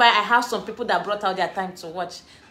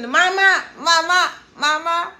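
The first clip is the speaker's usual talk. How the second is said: put-on sleepy child calling; 6 semitones higher, 3 dB louder, slower than usual. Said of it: "was calling".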